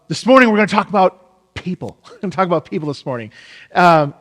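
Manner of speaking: usual speaking voice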